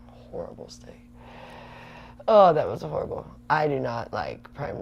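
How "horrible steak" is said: whispered, much softer, with disgust. Real, not acted. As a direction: whisper "Horrible steak." as if still sickened. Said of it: "One horrible steak".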